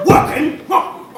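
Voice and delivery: high, loud indignant squawk; outraged surprise; startled. Acted. Judge startled by question.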